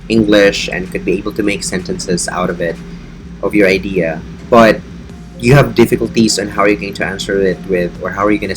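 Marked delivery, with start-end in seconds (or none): none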